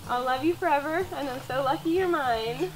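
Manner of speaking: ordinary delivery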